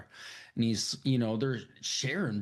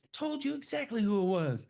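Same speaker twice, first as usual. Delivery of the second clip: put-on little girl voice; 11 semitones higher than usual. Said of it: "And she's like".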